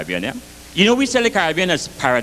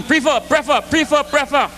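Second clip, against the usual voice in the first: higher, rapid back-and-forth prefer; mock argument; 7 semitones higher than usual. Bajan and Grenadian bickering, mock heated. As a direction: bat the word to and fro like a quarrel. Two men argue over the word.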